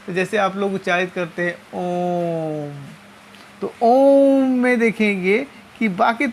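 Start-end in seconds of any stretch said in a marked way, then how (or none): 1.7-2.7 "ओम" drawn out several times longer than a normal vowel; prolonged vowel. Shown as a demonstration. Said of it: "कई गुना अधिक समय लगता है". 3.8-4.5 "ओम" stretched very long and steady; prolonged vowel. Shown as a demonstration. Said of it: "काफ़ी अधिक समय लग रही है".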